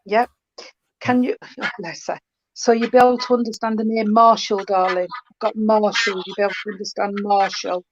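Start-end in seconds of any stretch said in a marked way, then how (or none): none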